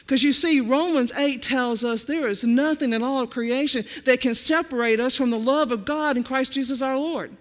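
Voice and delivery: ordinary talk, nothing marked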